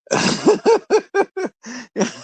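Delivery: a real high laugh; big laugh. Real, not acted. Laughing at own joke.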